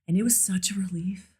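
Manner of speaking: breathed out in a whisper; relieved whisper. Real, not acted. Remembering the relief.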